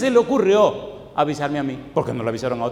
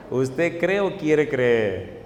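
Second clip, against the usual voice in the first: very slow probing; much slower than usual. Real, not acted.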